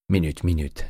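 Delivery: ordinary delivery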